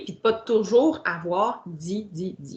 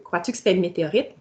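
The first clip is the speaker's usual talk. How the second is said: quick character line; much faster than usual. Shown as a demonstration. Teacher reading the quote quickly before the comma. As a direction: rattle off the question fast. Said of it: "virgule au début".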